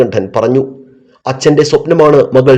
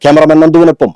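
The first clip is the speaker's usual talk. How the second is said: emphatic reporter read, phrase by phrase; TV news report. A narrator's reading. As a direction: deliver a scripted TV news report with falling phrase ends. TV reporter's scripted report and sign-off. (TV വാർത്താ റിപ്പോർട്ടറുടെ ശൈലി)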